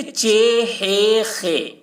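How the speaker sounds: چے، ہے، خے with drawn-out long e; مجہول pronunciation. Shown as a demonstration. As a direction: stretch each letter on "ay". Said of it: "مجہول پڑھا گیا ہے". (مجہول تلفظ، لمبی "اے")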